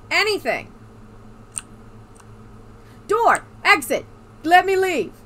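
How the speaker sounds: loud, high frustrated yelling; frustrated shout. Real, not acted. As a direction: yell for a way out. Yelling at the game for exit.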